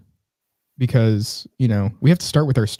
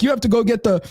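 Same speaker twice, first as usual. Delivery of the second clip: high-pitched mock Caribbean; imitated Caribbean accent; 9 semitones higher than usual. Deli worker instructs the customer, imitated Caribbean accent. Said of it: "this is the way she said it".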